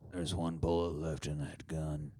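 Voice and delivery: very low, quiet grim rasp; low gruff Cable. Retold or imitated.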